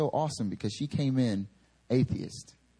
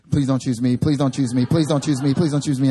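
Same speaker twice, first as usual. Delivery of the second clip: loud, urgent mock pleading, over and over; 4 semitones higher, 10 dB louder than usual. Preacher voicing a girl's silent plea, loud mock begging. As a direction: beg not to be picked, hammed up. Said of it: "She's sitting there like".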